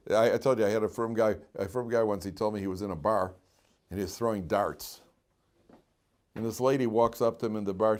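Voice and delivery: normal talking voice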